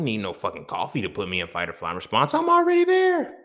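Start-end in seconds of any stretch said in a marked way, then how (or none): 2.3-3.4 very high, loud outburst; exasperated shout. Real, not acted. Podcaster protesting he needs no coffee, exasperated shout.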